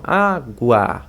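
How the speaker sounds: ÁGUA slowly split as "á... gua", full final A; drawn-out teaching pronunciation of água; syllable-by-syllable demo. Shown as a demonstration. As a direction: sound out água slowly, letting the A carry. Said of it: "ela tem um som mais fraquinho".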